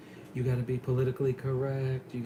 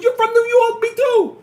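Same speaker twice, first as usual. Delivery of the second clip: much higher, loud cheerful shout; mock yell; 15 dB louder than usual. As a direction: holler it across lanes like old pals. Voicing driver yelling at red light.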